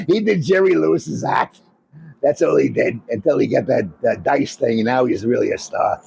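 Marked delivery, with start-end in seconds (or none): none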